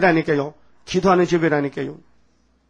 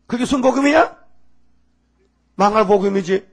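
high, loud sarcastic question; scornful jab; 5 semitones higher, 5 dB louder than usual. Real, not acted. Preacher mocks the church's name, scornful and sharp. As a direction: sneer at the name.

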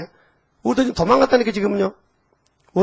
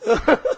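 high-pitched laugh; 9 semitones higher than usual. Real, not acted. Laughing at own army story.

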